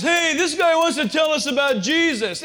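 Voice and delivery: a high, loud, mocking gang leader voice; mocking biker. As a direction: sneer it to the gang like a biker boss.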